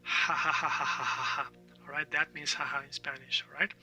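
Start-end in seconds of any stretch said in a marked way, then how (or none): 0.0-1.5 rapid run of ha-ha-ha; demonstrated laugh. Shown as a demonstration. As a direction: fake chat laugh with a Spanish J. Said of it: "you would say this".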